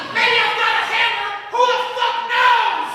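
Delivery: high, full-volume comic yelling; indignant shouting. Acted. Comic yelling at those asking how he got her, indignant shouting. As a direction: explode at the doubters in mock outrage. Climax of acted indignant rant.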